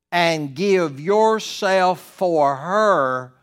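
loud, slow, drawn-out pulpit delivery; full-force preaching. Acted. Pastor exhorting husbands to give themselves, preaching loud.

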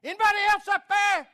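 very high, loud, fast comic squeal; hammed-up yell. Man on cliff seeks another helper, high mock yell. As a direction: holler upward in mock panic. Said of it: "the story goes that the guy said".

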